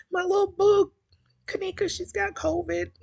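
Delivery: high-pitched fond lament; pitying coo. Real, not acted. Host telling viewers her little boo is sick, pitying coo.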